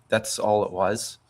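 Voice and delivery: usual speaking voice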